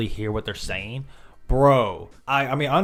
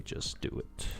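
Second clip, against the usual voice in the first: whispered; 16 dB quieter than usual. Real, not acted. Trailing whisper aside.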